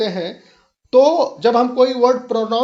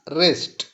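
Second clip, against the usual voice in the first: "Rest" unstressed, dropped lower; unstressed syllable; 6 semitones lower, 3 dB quieter than usual. Shown as a demonstration. Teacher splitting forest into two syllables, "rest" unstressed. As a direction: let "rest" fall away without accent. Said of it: "rest को हम जल्दी पढ़ते हैं".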